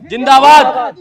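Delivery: very loud rallying shout; full-voiced political chant; slogan response. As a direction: shout it back as a crowd chant. Crowd answers the slogan.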